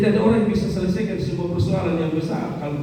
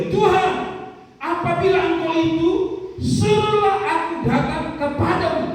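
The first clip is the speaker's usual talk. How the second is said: Peter's plea; 9 semitones higher than usual. Acted. Peter calling out to Jesus.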